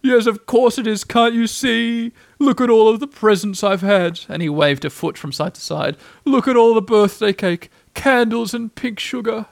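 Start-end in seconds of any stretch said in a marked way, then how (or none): 0.0-4.2 high, loud sarcastic display; loud, raised birthday boasting; Eeyore in mock cheer. A narrator's reading. 6.2-9.4 high, loud sarcastic display; loud, raised birthday boasting; Eeyore in mock cheer. A narrator's reading.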